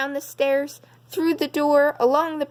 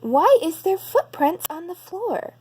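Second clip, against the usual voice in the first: higher mother bear voice, a touch puzzled; Mommy Bear wondering aloud, voiced up higher; soft low voice; 8 semitones higher than usual. Mommy Bear spots footprints, higher wondering mother voice. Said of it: "in her soft, low voice".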